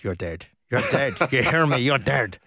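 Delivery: mock Irish-accented threat; Roy Keane. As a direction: imitate an Irish accent, intimidating an opponent in the tunnel. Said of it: "Sorry about the I-, Irish, uh, accent".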